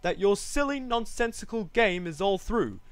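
high, indignant scolding; haughty, cross kangaroo complaint; snapping kangaroo. A narrator's reading. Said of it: "snapped the big kangaroo".

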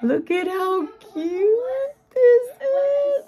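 very high, slow squealing coo; adoring squeal. Real, not acted. Crafter adoring her friend's present, high squeal. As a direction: squeal and drag out every adoring word. Gushing over a cute gift.

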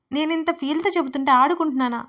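mock serious. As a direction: put on wounded earnestness, raising the voice.

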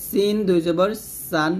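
clean light s in সিন and সান; thin sīn. Shown as a demonstration. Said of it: "জিহ্বা নিচের দাঁতের মাঝে লাগিয়ে". (সিন জিহ্বা নিচের দাঁতে লাগিয়ে পাতলা)

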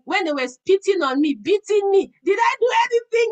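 loud, high, with a crying edge; fervent preaching cry; passionate preaching. Real, not acted. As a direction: preach it at full force, voice breaking. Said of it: "See how my flesh was screaming".

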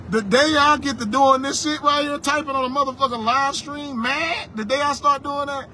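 ordinary delivery

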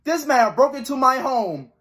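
ordinary talk

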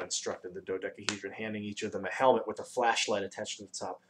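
ordinary delivery

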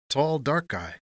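high-pitched voice for the porter; loose porter. A narrator's reading. Porter explains his drink to Tony, loosely.

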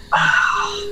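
sudden high, loud "Ah" mimicking the ghost; imitated ghost yell. Player retelling the ghost's sudden yell, high and loud. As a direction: yelp it out high like the ghost did. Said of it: "he went".

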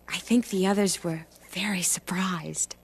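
soft unvoiced whisper. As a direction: breathe it out as a secret. Ruth confides her news to Naomi.

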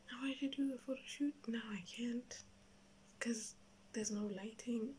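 quick hushed whisper. Real, not acted. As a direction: whisper it fast. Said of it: "I have to whisper".

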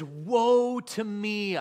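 high, loud and very slow wail; Isaiah wailing before the Lord; crying out. Acted. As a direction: cry it out in despair. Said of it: "I cried".

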